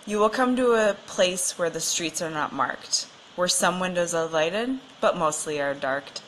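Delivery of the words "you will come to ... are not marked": usual speaking voice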